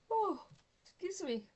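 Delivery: words stretched by a yawn; through a yawn. Real, not acted. Crafter yawning while showing papers to viewers, through a yawn. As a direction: yawn mid-word, then excuse yourself. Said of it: "I'm yawning".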